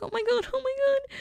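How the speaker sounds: very high panicked squealing. Retold or imitated. Friends' oars stuck, panicking.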